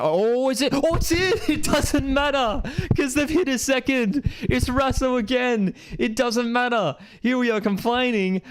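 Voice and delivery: high, laughing burst of joy; delighted shriek as the second goal goes in; elated surprise. Real, not acted. Streamer to viewers as Australia scores, high laughing joy.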